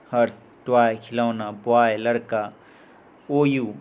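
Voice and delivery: usual speaking voice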